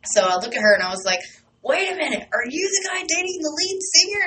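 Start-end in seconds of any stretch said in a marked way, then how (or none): 1.6-4.2 much higher, rising wait a minute; surprised realization. Retold or imitated. Recognizing her, quoting her own surprised high voice. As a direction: catch yourself in amazed recognition.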